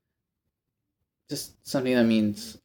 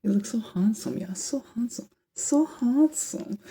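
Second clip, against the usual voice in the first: higher and higher hammed-up flattery, last words slow; mock gushing guys; 11 semitones higher, slower than usual. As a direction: ham up the gushing praise, climbing in pitch. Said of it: "a bunch of guys just being like".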